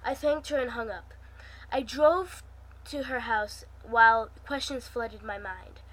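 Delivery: usual speaking voice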